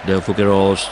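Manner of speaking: brisk running football commentary; steady Thai match call; live play-by-play. Acted.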